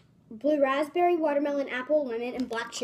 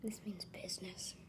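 hushed unvoiced whisper; 6 semitones lower, 17 dB quieter than usual. Real, not acted. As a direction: whisper it under your breath. Tasting very sour candy.

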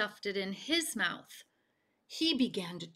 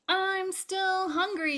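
higher, cheeky yell back; 10 semitones higher than usual. A narrator's reading. Bullfrog yelling back at the crowd. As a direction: shout it over your shoulder. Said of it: "yelled Bullfrog".

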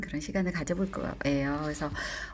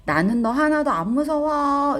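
high, loud, brave storybook yell; acted defiant cry, pitched up; demonstrated shout; 8 semitones higher, 6 dB louder than usual. Shown as a demonstration. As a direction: shout it out to scare a goblin away. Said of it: "이렇게 소리 지르면 되나 봐요".

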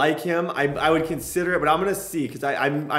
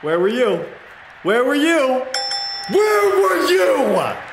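building taunt; 8 semitones higher, slower than usual. Real, not acted. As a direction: repeat it, rising each time to a shout.

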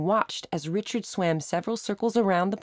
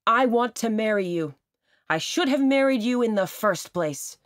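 high and loud public declaration; loud proposal pitched up high; very loudly; 6 semitones higher than usual. A narrator's reading. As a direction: call it across the park to her. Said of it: "very loudly now".

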